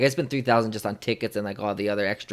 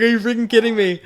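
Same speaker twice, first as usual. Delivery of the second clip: much higher and louder cry of disbelief, a notch below the first; disbelieving excitement; 12 semitones higher, 9 dB louder than usual. Retold or imitated. Fan repeats his reaction to the hug, excited high cry. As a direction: exclaim it, still overwhelmed.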